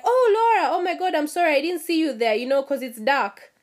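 hammed-up gushing sorry, tapering off; mock fake apology. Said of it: "I was like".

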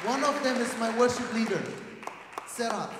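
loud, proud announcement; proudly announcing to the church; excited reveal. Real, not acted. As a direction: bring it up big for the crowd.

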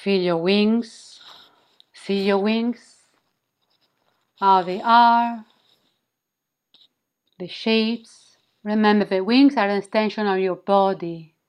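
slow, soothing meditation guide; sultry narrator. Acted. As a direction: ease them into calm, slowly. Back to guiding the meditation.

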